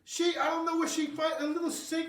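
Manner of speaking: high, giggly amazement at the tiny gadgets; laughing disbelief. Real, not acted. To the viewers about Mama's Mini Kitchens, laughing high. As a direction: laugh through it, high and delighted.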